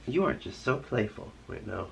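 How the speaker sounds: soft, affectionate aside; fond pet talk. Real, not acted. Owner turning to a playful pet, soft fond voice. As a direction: drop to a soft coo for a pet.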